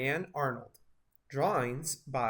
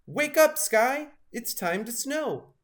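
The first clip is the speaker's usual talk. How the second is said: much higher and louder, calling up at the sky; shouting badger; 10 semitones higher, 5 dB louder than usual. A narrator's reading. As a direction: yell it to wake the sky. Badger bangs pots, calls sky.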